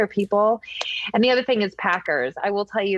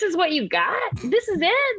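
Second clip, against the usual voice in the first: disbelief; 10 semitones higher than usual. Retold or imitated. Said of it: "I was like".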